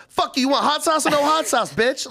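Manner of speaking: high, loud mock yell; retold yelling. A guy imitates the deli lady cussing out customers, loud mock yell. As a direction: bark it like a furious cook. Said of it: "the only thing I've seen her say".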